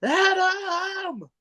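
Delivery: very high, drawn-out mock yell; high, stretched call from the crowd; retold heckling yell. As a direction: imitate a crowd heckle without full volume. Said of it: "I just yelled out".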